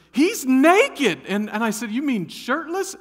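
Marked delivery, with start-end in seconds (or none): 0.1-1.2 loud high repeat, pressing it home; loud insistence. Retold or imitated. Wife repeating the warning to husband, loud and high. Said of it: "And she goes". 1.9-2.9 high incredulous question. Retold or imitated. Husband checking with wife, incredulous and high.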